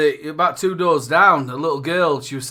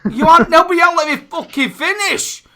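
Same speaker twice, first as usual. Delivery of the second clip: high, loud, laughing protest; excited protest; 13 semitones higher, 7 dB louder than usual. Real, not acted. Host protesting co-host won't let him finish, high and loud. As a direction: cut him off in mock outrage. Mock row between hosts.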